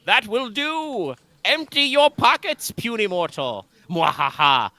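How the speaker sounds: put-on very high, slow haughty demon girl; sneering panto villain. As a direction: demand it like a bratty tyrant. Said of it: "bratty member of the girl gang".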